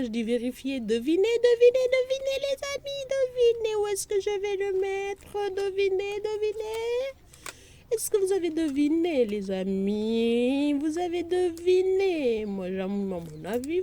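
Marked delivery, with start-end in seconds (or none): 0.8-12.5 much higher and louder singsong guessing game; playful teasing. Real, not acted. Vlogger urging viewers to guess, high playful singsong. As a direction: tease them like a quiz host.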